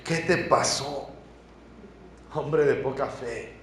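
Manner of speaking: slow, tender question to a friend; Jesus gently chiding Pedro, slow and tender; gentle reproach. Retold or imitated. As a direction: ask him gently, eye to eye. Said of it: "mirándolo a los ojos".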